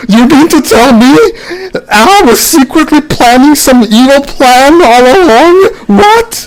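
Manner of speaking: high, hammed-up gasp of disbelief; mock shock. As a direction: overplay the shocked reaction. Mocking the villain reveal.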